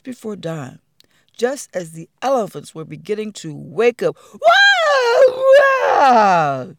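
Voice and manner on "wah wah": high loud wailing cry; baby elephant wail. Acted. Baby elephant wailing at the sight of Big Baba.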